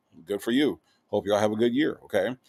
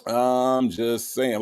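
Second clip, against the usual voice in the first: drawn-out tease; much slower than usual. Real, not acted. Drawn-out teasing repeat.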